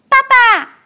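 高声尖叫着喊爸爸; 小孩子扑过去大喊; 宝宝喊爸爸. Acted. 小恐龙大声喊爸爸. (小恐龙高声大喊爸爸)

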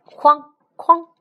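very loud high bang-bang noise; loud, high imitated thuds of heavy steps; booming stomps. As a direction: stomp it out like giant footsteps. Yeti's footsteps.

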